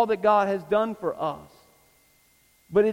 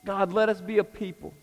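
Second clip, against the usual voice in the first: public prayer. Real, not acted. Pastor asks God to grow the congregation, earnest closing prayer. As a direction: pray it out phrase by phrase, with long pauses.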